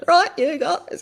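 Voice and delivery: cracking up into a squeaky high pitch; mock the sergeant's breaking voice. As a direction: drill sergeant whose voice cracks high mid-order. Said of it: "whose voice used to break".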